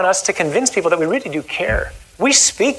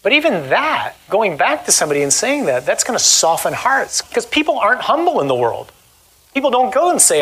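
ordinary talk

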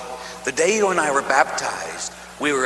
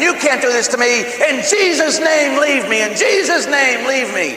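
high, louder desperate commands; desperate high plea; 9 semitones higher, 4 dB louder than usual. Retold or imitated. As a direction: cry out in panic. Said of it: "And I'm saying".

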